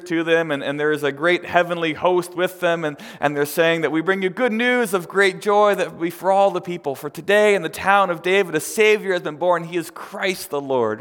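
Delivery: ringing announcement, high and loud; full-force preaching. Acted. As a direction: proclaim the good news to a crowd. Preaches the angels' news.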